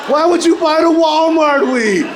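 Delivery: high, loud mock outrage. Real, not acted. Comic scolding an imagined buyer, high and loud.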